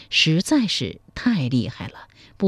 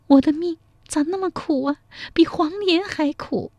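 put-on high, wailing cry of a young woman; 12 semitones higher than usual. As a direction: wail it through tears. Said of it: "一边哭 一边说".

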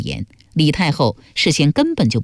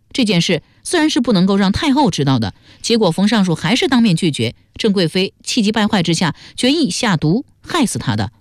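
higher-pitched, eager character voice; Yu Baobao, speculating; 5 semitones higher than usual. Acted. Guessing at the palace plot to companions, eagerly. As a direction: float the theory in a high, lively voice.

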